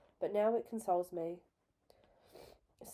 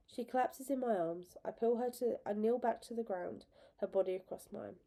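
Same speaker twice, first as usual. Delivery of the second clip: plain reading voice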